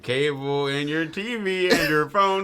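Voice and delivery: loud, slow laughing words. Real, not acted. Friend jokes about basic necessities, laughing loudly. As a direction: say it through laughter.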